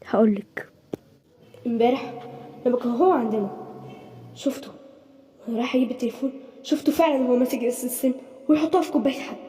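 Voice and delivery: low, soft retelling; hushed confession. Acted. Boy telling mother what he saw, hushed. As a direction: tell it as a secret. Boy tells secret quietly.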